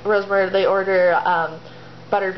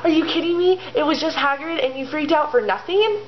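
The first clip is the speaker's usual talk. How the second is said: high-pitched, acted exasperation; freaking out; 8 semitones higher than usual. Ron to the girls after a false alarm, exasperated. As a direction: scold them in a worked-up high voice. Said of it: "having a spaz attack".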